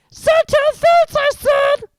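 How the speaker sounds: very high, loud mock-innocent voice; saintly poltergeist. A narrator's reading. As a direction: play the little saint, falsely sweet. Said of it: "in a saintly voice".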